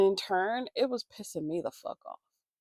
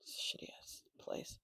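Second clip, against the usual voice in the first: whispered aside; 15 dB quieter than usual. Real, not acted. Muttered aside about Monrovia.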